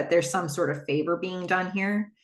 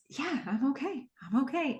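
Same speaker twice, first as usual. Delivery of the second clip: softer, higher, gently reassuring; soft reassurance; 7 semitones higher, 7 dB quieter than usual. Retold or imitated. Husband softly reassures his tearful wife, soft and tender. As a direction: soothe her softly, like it's all fine.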